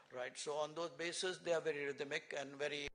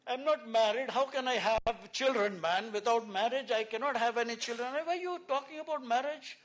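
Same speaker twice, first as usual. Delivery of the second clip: loud, high, indignant outburst; exasperated, raised-voice telling-off; angry retort; 8 semitones higher, 9 dB louder than usual. Retold or imitated. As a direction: re-enact losing your temper at a nosy stranger. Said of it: "I got a little angry there".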